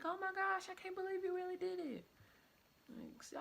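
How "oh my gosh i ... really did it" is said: high, fast shocked mock yell of the friend. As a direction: squeal the disbelief like the stunned friend. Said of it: "she started yelling like".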